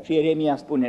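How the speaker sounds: ordinary delivery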